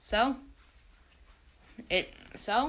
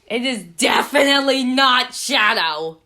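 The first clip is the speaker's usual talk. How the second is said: shouted; 3 semitones higher, 10 dB louder than usual. Real, not acted. Shouted joking denial.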